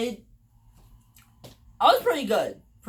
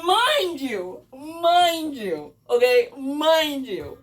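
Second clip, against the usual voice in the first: "mind you" stretched long and raised in pitch; drawn-out emphasis; 4 semitones higher, much slower than usual. Real, not acted. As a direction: make a slow, high refrain of "mind you". Repeats aside to build suspense.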